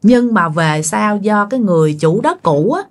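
plain reading voice

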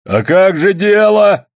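high, loud drawn-out cry; frenzied shout. A narrator's reading. Old man in the claim dispute, frenzied shout. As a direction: shout high and beside yourself. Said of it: "закричал исступлённо старик".

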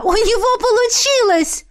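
high-pitched delighted cry; joyful exclamation. A narrator's reading. Heroine cheering the necromancer's success, joyful exclamation. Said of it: "воскликнула я".

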